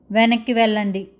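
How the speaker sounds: usual speaking voice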